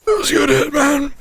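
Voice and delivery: high, strained stoner voice after a hit; high stoned slacker voice; stoner impression. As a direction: squeak it out like you are holding in smoke. Imitates the character smoking.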